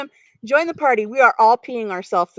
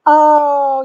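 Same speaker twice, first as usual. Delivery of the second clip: sudden loud high oh after a gasp; real startled gasp; gasp of surprise; 7 semitones higher, 7 dB louder than usual. Real, not acted. Spotting a deer mid-stream, gasping oh, loud and high. Sees deer running across field.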